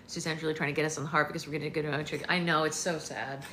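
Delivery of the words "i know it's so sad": usual speaking voice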